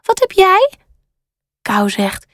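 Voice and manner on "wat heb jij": high, rising question; curious storybook panda. A narrator's reading. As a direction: ask it high, eager to know. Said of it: "kijkt nieuwsgierig naar Kou en vraagt".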